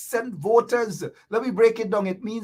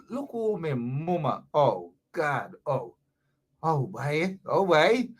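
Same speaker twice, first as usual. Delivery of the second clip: mock lament; 5 semitones lower, 4 dB quieter than usual. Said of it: "That's another term on the quarantine".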